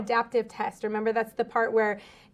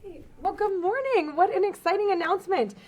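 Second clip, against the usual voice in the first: excited; 8 semitones higher than usual. Real, not acted. Teacher greets after student announcement.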